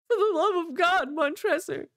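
high, loud anguished cry; desperate plea. Acted. Walled-in Fortunato begging Montresor for mercy, a high anguished cry. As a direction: scream it in terror. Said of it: "Fortunato's final desperate plea".

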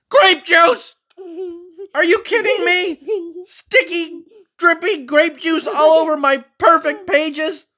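high, loud frantic frog; frog panicking. Acted. Finn the Frog panics at grape juice on his pages, high and loud. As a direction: lose it over the ruined pages.

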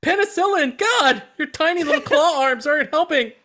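high, loud frantic toy voice; frantic mock medic. Toy soldier yelling at the medic dinosaur: high, loud panic. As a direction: panic like a battlefield medic, hammed up. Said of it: "He's like".

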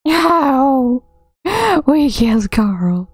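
high mock wail of no; hammed-up cry. Mourning robot Carl, hammed-up cry. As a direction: wail it in fake grief.

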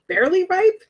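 surprised rising question; incredulous. Real, not acted. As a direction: pitch jumps up in disbelief. Puzzled at description.

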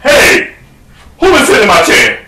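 indignant bear calling out, starting high; Papa Bear. Acted. Father bear at his seat, indignant demand. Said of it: "then Pop go over to his seat".

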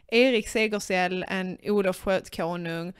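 ordinary delivery

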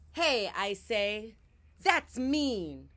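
high and loud, a brave shout; bold call-out. Acted. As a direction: burst out to stop the bully. Dot stands up to bully.

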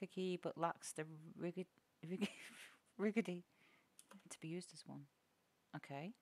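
murmuring the item description softly; quiet reading. Real, not acted. Streamer reading item descriptions aloud, quietly. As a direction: read the game text under your breath.

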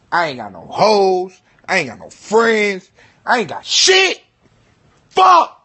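building from loud to a high, loud shouted "Fuck"; frustrated rant. Real, not acted. Alone and bored, venting about no friends, rising to a yell.

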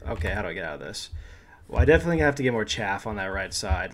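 ordinary talk

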